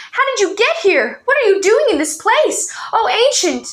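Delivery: amazed reunion. A narrator's reading. Boy reunited with friends, amazed and excited. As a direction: pour out the surprise at seeing them.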